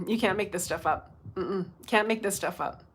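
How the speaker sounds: usual speaking voice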